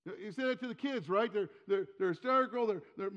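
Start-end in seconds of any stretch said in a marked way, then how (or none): none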